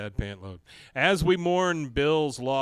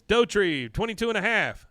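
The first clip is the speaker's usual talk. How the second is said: announcing scores; 9 semitones higher, 7 dB louder than usual. Retold or imitated. Referee announcing contest scores.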